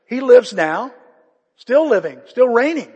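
loud, ringing proclamation; full-force preaching. Acted. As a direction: declare it loud to the whole church. Preacher proclaiming Christ lives.

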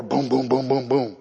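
low, heavy boom boom mimicking knocks; mock low pounding booms; door pounding.

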